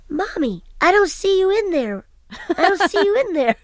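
put-on high little-boy voice, puzzled; small child's voice. Two-year-old son puzzled at the talking doll, high child voice. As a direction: play a toddler peering into a talking doll.

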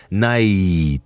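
"night" stretched long, closing early to an ee glide; slow sliding "night". Retold or imitated. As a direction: draw out "night" on the diphthong. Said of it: "[name] sang Strangers in the Night wrong".

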